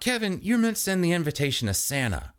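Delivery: high-pitched, testy complaint; high, mildly growled reproach; irritated growl. A narrator's reading. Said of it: "Chris growled at his hand-warming companion".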